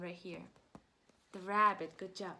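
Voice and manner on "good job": usual speaking voice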